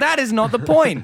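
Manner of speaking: high, loud mock exasperation; exasperated outburst. Comedian answering picky voters, high and loud exasperation. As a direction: burst out at their stupidity.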